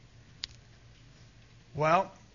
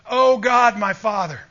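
mock crying out; 4 semitones higher, 9 dB louder than usual. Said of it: "you cry out".

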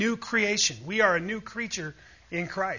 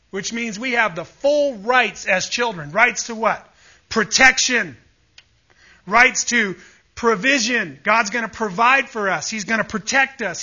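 loud, rising preacher's build; preaching at full force; 4 semitones higher, 7 dB louder than usual. Acted. Preacher to congregation, listing God's promises, loud and building. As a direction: drive each promise home to the congregation.

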